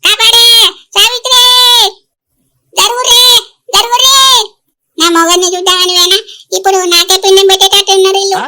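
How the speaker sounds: loud, high, wailing mock scream; crying shout for help. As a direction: shriek for Savitri to save her. (ఏడుస్తూ గట్టిగా కాపాడమని అరుపు)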